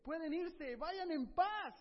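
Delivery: alegre, más alto y más fuerte; anúnciales la libertad con alegría; carcelero feliz. Acted. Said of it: "bien feliz, le dice". (carcelero feliz anuncia a Pablo la libertad, alegre y alto)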